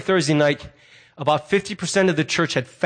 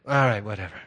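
low, falling, unbothered mumble; dismissive teenager; 9 semitones lower, 5 dB quieter, faster than usual. Acted. Demon answering the exorcist, low teenage dismissiveness. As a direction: give in like an unimpressed teenager. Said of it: "are like teenagers".

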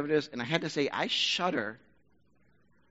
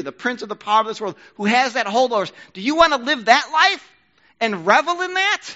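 full-force preaching; 8 semitones higher, 12 dB louder than usual. Acted. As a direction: preach it loud and much higher, pressing the crowd.

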